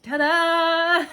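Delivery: cheerful showy finish; ta-da flourish. Pretend. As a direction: present it with a flourish.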